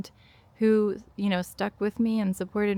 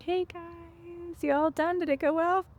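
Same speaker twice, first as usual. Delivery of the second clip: bright, much higher, put-on breezy tone as if nothing happened; cheery mom voice; 9 semitones higher than usual. Mom picking up her kids after crying in the car, forced cheery greeting. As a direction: sing it out high and light to cover the tears.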